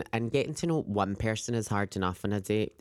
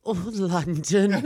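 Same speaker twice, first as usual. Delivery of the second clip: higher, slow mock-impressed drawl; 7 semitones higher, 3 dB louder, slower than usual. Teasing the girl from London, mock impressed. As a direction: act dazzled to tease her.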